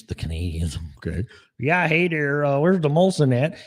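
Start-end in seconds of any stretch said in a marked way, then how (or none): 1.6-3.6 high, hammed-up Canadian accent; jokey Canadian accent, pitched up; mock Canadian accent. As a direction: play a cartoon Canadian asking for beer. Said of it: "stupid fucking Canadians".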